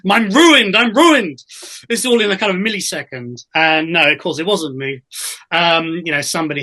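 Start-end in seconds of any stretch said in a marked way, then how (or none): 0.1-1.4 loud, high, hammed-up despair; mock panic. Insecure actor to himself after the show is stopped, mock panic. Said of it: "actors, uh, in security, uh, going".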